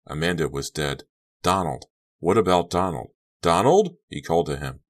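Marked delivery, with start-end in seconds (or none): 3.4-4.0 loud, high call of a name; urgent shout of a name. A narrator's reading. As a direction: call out to a brother in the wreck. Kerry calls to his brother.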